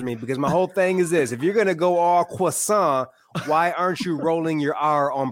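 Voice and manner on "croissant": croissant said the affected French way, "kwa-SAHN"; mock French accent. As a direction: put on a pretentious French accent for croissant, nasal ending and silent T. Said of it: "say croissant with an accent".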